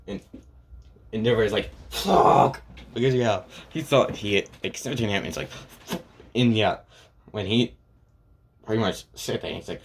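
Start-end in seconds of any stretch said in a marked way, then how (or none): 1.9-2.6 sharp, higher, louder breathy curse; frustrated outburst at a failed plan. Retold or imitated. Endeavor's plan fails. 5.5-6.0 breathless, voiceless under-the-breath curse; whispered frustrated curse; muttered curse. Retold or imitated. Endeavor cursing to himself.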